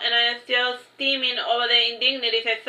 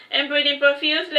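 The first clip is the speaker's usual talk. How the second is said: ordinary delivery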